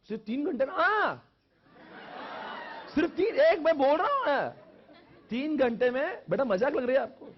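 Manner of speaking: high, loud, incredulous questioning; hammed-up shocked outburst; mock disbelief. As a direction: act astonished, pitch jumping up. Acting out disbelief at three-hour revision.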